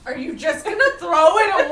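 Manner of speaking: put-on high, loud laughing little girl voice; high, loud laughing daughter asking about the dead bird; laughing child quote. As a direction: squeal it out laughing.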